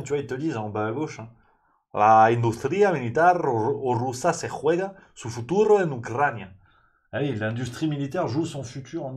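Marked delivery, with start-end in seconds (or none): 1.9-6.5 careful Spanish read of a newspaper line; Spanish headline reading. Shown as a demonstration. Reads Spanish headline aloud.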